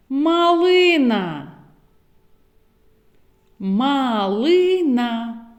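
Фламінго stretched very slowly, Ф held; very slow word. Shown as a demonstration. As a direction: drag out Фламінго for listening. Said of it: "зловити звук Ф в словах". (дуже повільно Фламінго)

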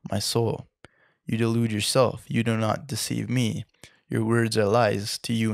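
plain reading voice

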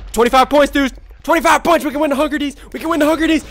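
high, loud excited cheering; mounting excitement. Real, not acted. Gamer points away from winning, excited shouting. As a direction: urge the team to victory. About to win the match.

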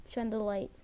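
ordinary delivery

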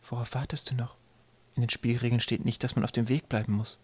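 soft little inner voice; small fine voice. A narrator's reading. Tiny voice in Twig's head urging him on, softly. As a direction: murmur it like a thought in his head. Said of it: "ein feines Stimmchen in seinem Kopf".

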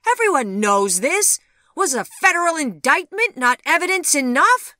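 loud, high exasperated retort; heated, high-pitched protest; indignant cry. A narrator's reading. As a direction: argue back at the top of your voice. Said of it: "cried Vivian".

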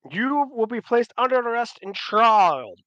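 high, loud, declaimed verdict; official proclamation. Acted. Giles tells governor of arrest and trial, loud proclamation. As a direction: pronounce it for the courtyard.

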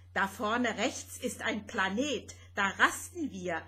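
plain reading voice

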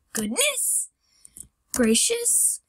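squeaky high goodness gracious; high exclamation. Real, not acted. Exclamation after fail.

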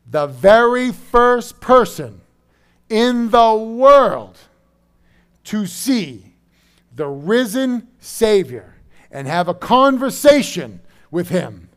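loud, high, slow proclamation; full-force preaching. Real, not acted. Preacher on the first person to see the risen Savior, full-force preaching. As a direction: proclaim it slowly at full volume.

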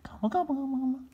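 very high gobbling; turkey gobble. Acted. Third turkey gobbling behind a tree, very high.

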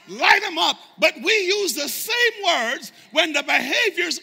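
loud, high, hammering preacher cry; shouting sermon climax; full-force preaching. Acted. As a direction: preach it out at the top of the voice.

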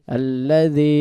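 আল্লাযী with the ই held three counts; long vowel demo. Shown as a demonstration. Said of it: "তিন আলিফ টানতে হবে". (আল্লাযী শব্দে ই তিন আলিফ টেনে)

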